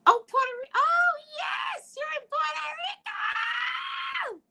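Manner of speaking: high, loud thrilled squeal, slowing; excited shout. Real, not acted. Greeting a caller from Puerto Rico with a delighted high shout. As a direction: shriek with delight at the caller's place.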